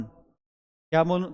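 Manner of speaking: ordinary delivery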